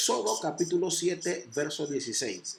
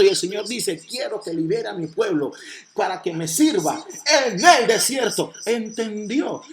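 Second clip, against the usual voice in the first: raised, insistent preacher's voice; full-force preaching; 4 semitones higher, 5 dB louder than usual. Real, not acted. Preacher tells congregation the desert has nothing, preaching forcefully. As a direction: drive each phrase home louder, building to a peak.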